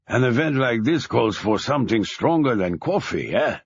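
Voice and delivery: heavy German accent, lightly voiced. A narrator's reading. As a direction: speak English with a thick German accent. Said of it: "with a heavy German accent".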